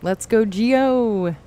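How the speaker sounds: slow, loud hype call; hyped. Real, not acted. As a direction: call it out, pumped. Launching the game.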